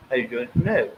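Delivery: posh British accent. Shown as a demonstration. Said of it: "I can speak little bit in a British accent".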